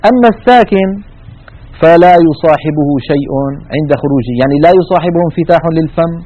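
ordinary delivery

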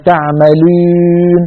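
تعملون slow with the waw fronted toward a ya, Turkish style; waw mixed with ya. Shown as a demonstration. Teacher imitating Turkish students' waw. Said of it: "يخلطونها بالياء".

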